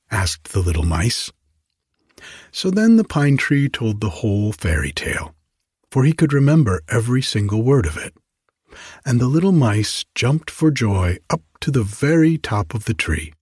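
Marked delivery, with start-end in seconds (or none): none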